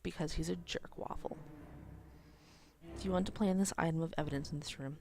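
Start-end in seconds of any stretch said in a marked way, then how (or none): 0.0-1.4 softer aside calling him "jerk waffle"; muttered insult. Real, not acted. Player insulting the father quietly, softer. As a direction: say "jerk waffle" under your breath. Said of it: "Jerk waffle, not related to the Belgian waffle".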